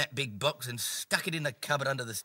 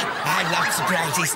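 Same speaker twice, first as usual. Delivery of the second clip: ordinary delivery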